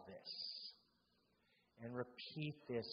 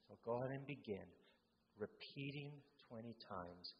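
normal talking voice

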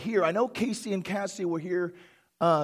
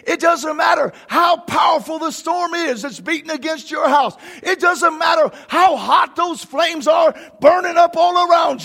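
loud and higher, building to a near-tearful cry; rising fervent preaching; 7 semitones higher, 8 dB louder than usual. Real, not acted. Pastor assuring church God carries them through storms, fervent shout. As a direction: preach it to the roof.

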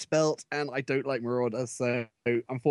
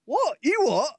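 high, loud mock Cockney; hammed-up, incredulous Cockney squawk; stupid Cockney accent; 5 dB louder than usual. Co-host teasing Americans who don't know the term, in mock Cockney. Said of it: "read out in a stupid Cockney accent".

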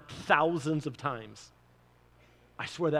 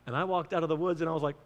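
ordinary delivery

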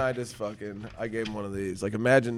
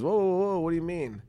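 a hint of a higher startled bird voice; mock startled bird; 6 semitones higher than usual. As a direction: play the bird, startled and defensive. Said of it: "And he goes".

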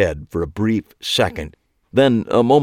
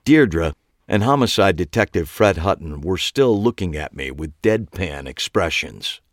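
plain reading voice

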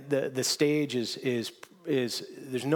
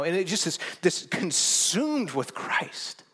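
normal talking voice

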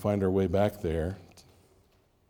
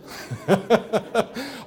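real laugh that pitches high; laughing at himself; 11 semitones higher than usual. Real, not acted. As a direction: let a sudden laugh break out at yourself. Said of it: "Okay, all right, all right".

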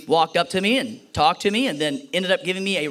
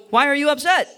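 high, louder sing-song parent voice; parent to a child; 9 semitones higher, 5 dB louder, faster than usual. Shown as a demonstration. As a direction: talk down to a sulking kid. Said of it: "And we go".

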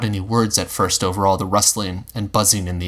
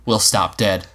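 ordinary delivery, nothing marked

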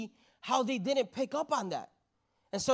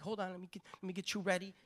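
hushed fussing; 9 dB quieter, much faster than usual. Acted. Bridesmaid getting the bride ready, hushed fussing. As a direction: fuss quietly over someone.

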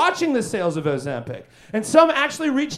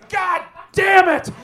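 angry outburst; 7 semitones higher, 4 dB louder, slower than usual. Acted. Angry outburst mid rant.

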